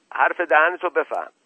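loud, high warning; furious shout. A narrator's reading. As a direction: shout it red-faced at an opponent. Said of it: "با رنگ و روی برافروخته فریاد زد". (فریاد برافروخته و خشمگین)

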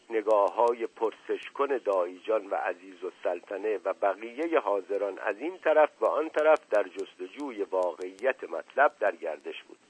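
ordinary delivery, nothing marked

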